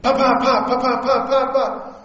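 playful imitation of distant firecrackers; mock firecracker pops. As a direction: pop out the firecracker noise with a laugh at the end. Imitating firecrackers at outdoor meeting.

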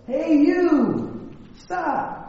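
high echo of a yell, softening at the end. Acted. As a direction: imitate a call bouncing back from the hills. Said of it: "And the voice says".

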